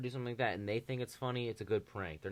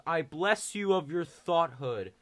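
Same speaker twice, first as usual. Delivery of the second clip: mock priest blessing; 7 semitones higher, 8 dB louder, slower than usual.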